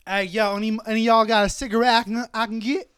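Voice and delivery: hyped-up junkie begging strangers for a cigarette; retold crackhead bumming a smoke. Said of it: "He's all cracked out".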